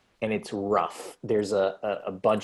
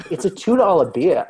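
scoffing; 9 semitones higher, 8 dB louder than usual. Retold or imitated. Tom scoffing indignantly.

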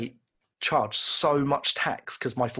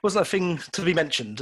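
higher, louder curt retort to mum; mock curt reply; 6 semitones higher, 3 dB louder than usual. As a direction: brush off mum's question with a tight smile.

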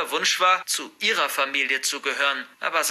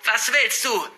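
high, sharp challenge; raised-pitch angry demand; defiant shout; 7 semitones higher, 3 dB louder than usual. A narrator's reading. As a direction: yell it at someone threatening you. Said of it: "schrie ich".

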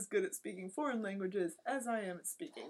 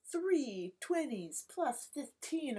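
slow, higher, spelled-out sum; mock laborious counting; 5 semitones higher, much slower than usual. Counting in French past a certain point, slow mock calculation. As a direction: work it out word by word.

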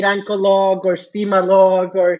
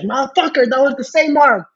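mild mock yell, pitched up; indignant, higher-pitched outburst; retold angry scream; 6 semitones higher than usual. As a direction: imitate someone screaming in your face, at moderate volume. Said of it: "he just screamed in my face".

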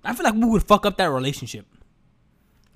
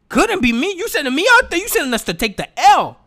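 pitched way up in mock disbelief; incredulous; 9 semitones higher, 4 dB louder than usual. To the officer drafting him, mock disbelief. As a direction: squeak out can't-be-me protest.

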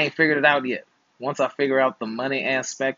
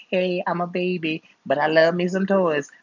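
put-on cute baby voice; 7 semitones higher than usual. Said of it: "looking all beautiful and fresh and young and like".

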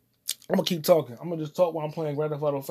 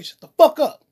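high, loud snapping shout; angry yell; 10 semitones higher, 9 dB louder than usual. Retold or imitated. Quoting Fox shouting at the apologizer, loud and high. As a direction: bark it back to silence him. Fox snaps back in quote.

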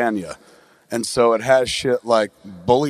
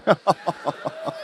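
amused laugh; 4 semitones higher, 4 dB quieter than usual. Real, not acted. Laughing at own pun.

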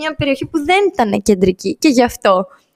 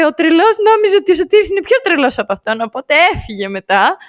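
ordinary talk, nothing marked

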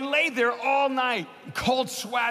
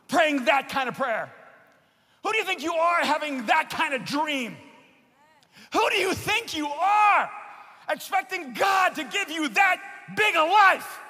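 raised, ringing preacher voice; preaching at full force; 6 semitones higher than usual. Acted. Preacher urging the congregation, in full sermon force.

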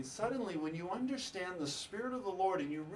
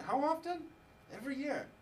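retold disbelief; 11 semitones higher than usual. Retold guest's disbelief at annual event.

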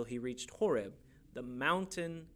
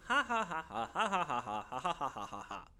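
staged ha-ha-ha stepping down; performed laugh from a lyric; scripted song laugh. Shown as a demonstration. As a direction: recite laughter in even beats. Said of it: "I'm supposed to laugh".

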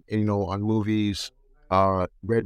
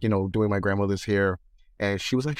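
normal talking voice

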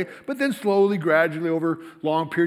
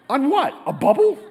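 incredulous joke; 9 semitones higher, 6 dB louder than usual. Acted. Joking about goldfish scientist.

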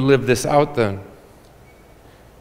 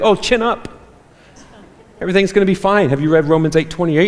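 much higher, bright and cheery pep talk; sing-song cheer-up of someone who is hurting; chirpy well-meaning friend; 6 semitones higher, faster than usual. Retold or imitated. Preacher imitating a cheerful church friend, chirpy. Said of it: "well-meaning brother or sister be like".

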